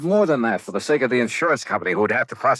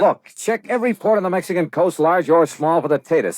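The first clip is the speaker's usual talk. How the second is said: urgent order; 6 semitones higher than usual. Acted. Investigator orders a coastal search.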